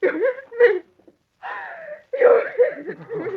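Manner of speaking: high, tearful disbelief; shocked crying. Acted. Slapped wife crying out at her husband. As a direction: sob it out in shock.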